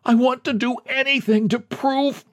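very high-pitched desperate pleading; high, piteous plea that keeps pressing on; pitiful begging. A narrator's reading. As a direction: beg pitifully, pitch far up. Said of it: "He begged pitifully".

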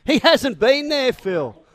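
an imagined snap of frustration, half played up; exasperated retort. The host imagines answering Phil through the paper, in exasperated mock protest. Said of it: "I felt like jumping through the paper and saying".

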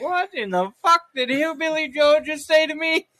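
high, loud, bewildered mock outburst; baffled reaction. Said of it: "and they're like".

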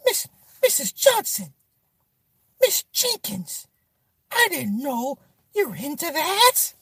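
high, breathless mock-scandalized falsetto; mock shocked gasp. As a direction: clutch your pearls at Miss Jenkins. Acting shocked at neighbor lady.

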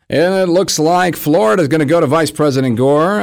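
lightly imitating a TV anchor; news anchor voice. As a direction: read it like a network call. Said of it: "it went like this".

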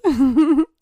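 high, loud, bright giggle; a playful dismissive giggle. A narrator's reading. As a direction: giggle it off lightly. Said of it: "she giggled".

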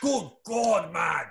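high, drawn-out mock-English calls for order; hammed-up English accent, mouth stretched wide; open-mouthed English accent. Shown as a demonstration. Host demos a fourth accent calling order, open-mouthed English. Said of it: "like, one with your mouth open wide".

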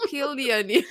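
giggling through a quoted line; real laughter breaking the quote; laughing. Real, not acted. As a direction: quote it while laughing almost to tears. Quoting Avery while laughing.

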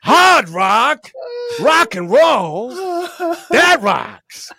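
slow, loud, high hammed-up Pacino announcer; half-sung rock promo as Pacino; Al Pacino impression. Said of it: "with that as Pacino".

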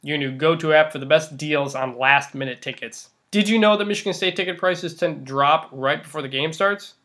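upbeat sponsor read. Acted. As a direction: sell it like a radio spot.

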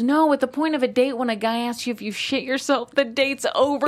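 high, insistent retold scolding; acted exasperated advice; emphatic sister.

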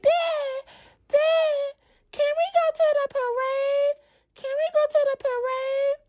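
put-on high pleading child voice; begging little girl. Little girl begging her dad to go, high child voice. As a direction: beg like a small kid.

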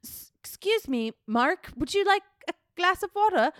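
put-on the nervous young intern, trying too hard to be proper. Intern nervously offering Mark water, high timid politeness. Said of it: "Did you do an English accent?".

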